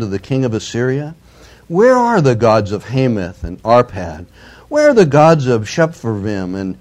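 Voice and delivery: loud, declaiming the envoy's taunt; forceful scripture reading. Acted. Assyrian envoy taunting Jerusalem, read out loud and forceful. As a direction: proclaim it like a herald's challenge.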